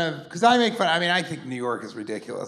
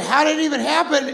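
much higher, louder disbelieving ask; incredulous high question; 11 semitones higher, 5 dB louder, faster than usual. Retold or imitated. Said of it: "And I said".

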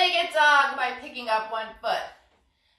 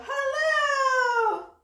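much higher, giggly hello; playful peekaboo hello; 12 semitones higher than usual. Pretend. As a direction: pop out with a silly hello. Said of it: "peeking head through legs".

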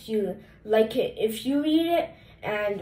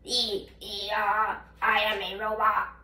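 halting robot voice, lightly played; toy robot voice. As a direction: stutter it out like a toy robot, laughing. Kid voicing the book's robot.

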